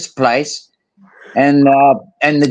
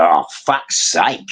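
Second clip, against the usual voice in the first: exasperated; 11 semitones lower, 3 dB quieter, slower than usual. Retold or imitated. Retold exasperated reply.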